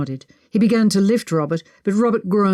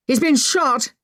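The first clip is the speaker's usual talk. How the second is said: shouting; 7 semitones higher than usual. A narrator's reading. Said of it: "Edwin shouted".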